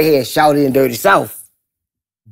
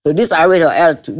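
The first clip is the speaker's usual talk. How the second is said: usual speaking voice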